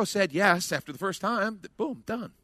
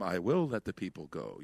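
low, soft, dazed monotone; Jedi-mind-tricked; 10 semitones lower, 8 dB quieter than usual. Acted. Pharaoh under a Jedi trick, low dazed monotone. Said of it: "like someone does when a Jedi does them".

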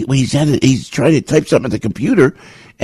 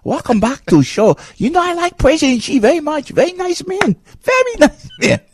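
much higher, hammed-up Chinese accent praising President Xi; mock Chinese accent; 12 semitones higher than usual. Radio host parodies a state broadcaster, high mock Chinese accent. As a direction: gush over the leader in a squeaky Chinese accent. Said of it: "That is a communist Chinese accent".

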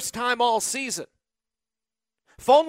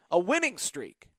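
normal talking voice

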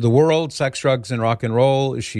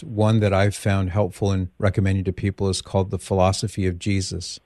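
usual speaking voice

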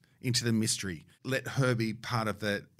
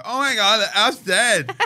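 mock-horrified cry, higher and louder, laughing through it; laughing mock shout; 11 semitones higher, 11 dB louder than usual. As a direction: fake alarm while cracking up. Acting out fake elf tragedy.

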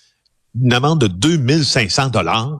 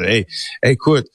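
usual speaking voice

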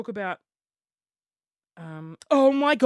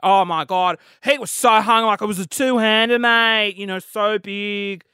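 louder, gushing put-on voice for gossiping guys; 7 dB louder than usual. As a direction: gush about it like a gossiping friend. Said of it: "I don't know why I put on".

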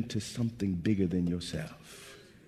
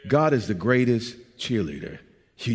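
ordinary talk, nothing marked

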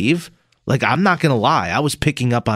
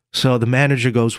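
ordinary talk, nothing marked

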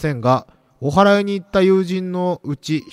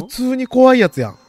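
spooked exclaim; 8 semitones higher, 3 dB louder than usual. Real, not acted. Repeats reaction louder.

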